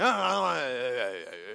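loud groan sliding downward; mock groan, trailing down; grumpy wordless grumble. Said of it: "don't have the luxury of saying".

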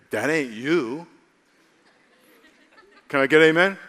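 usual speaking voice

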